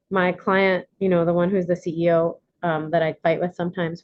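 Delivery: normal talking voice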